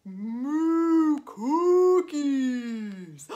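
long, loud mooed words; drawn-out bellowing cow. Pretend. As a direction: moo the words out very slowly like a cow. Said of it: "What are you making, cow?".

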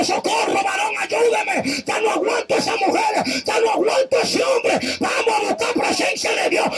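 pleading preacher at full force; fervent preaching. Acted.

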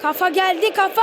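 rising excited shout of the header; excited gamer yelling at a header; excited call. Real, not acted. Football game commentary.

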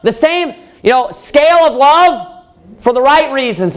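rising sermon climax; full-force preaching. Acted. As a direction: drive it home to the congregation.

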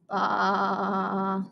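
long, soft imitated baa; sheep bleat. Shown as a demonstration. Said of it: "Sheep baa".

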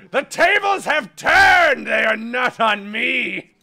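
slow, high gloating yell; drawn-out victory shout; triumphant shout. Real, not acted. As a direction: crow over the reversal. Gloating over turned fight.

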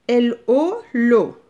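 L O spelled loud, then l'eau sounded out; spelled-out syllable. Shown as a demonstration. Teacher models a syllable for children, loud and clear. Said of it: "je vais faire le premier exemple avec vous".

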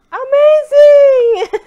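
high loud slow laughing cry; ecstatic. Real, not acted. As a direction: shout it in delight. Tasting crab, ecstatic.

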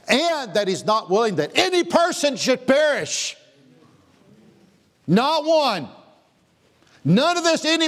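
full-force preaching. Acted. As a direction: preach it out over the congregation.

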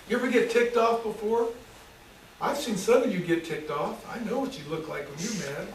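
ordinary talk, nothing marked

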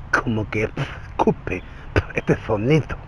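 soft, spitty lisp on "escupe" and "sonido"; ceceo escupido. Shown as a demonstration. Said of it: "él habla así". ("sonido" ceceado, como que escupe)

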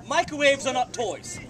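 ordinary delivery

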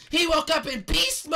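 high, loud yell; triumphant shout. Pretend. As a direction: holler it with a long last word. Bart shouts his answer.

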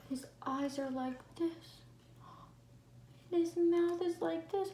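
breathy gasps, scared whisper; gasping whisper. Acted. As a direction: gasp and whisper like you're startled. Acting out monster's face, gasping.

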